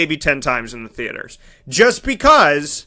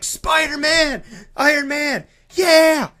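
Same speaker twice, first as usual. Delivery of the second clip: put-on excited kid; 7 semitones higher, slower than usual. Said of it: "the kid behind me that was like".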